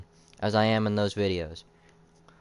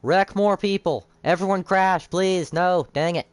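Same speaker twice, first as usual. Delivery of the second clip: vintage cartoon voice; 7 semitones higher than usual. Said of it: "I do talk like a cartoon sometimes".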